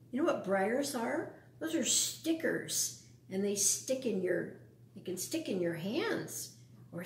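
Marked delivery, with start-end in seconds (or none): none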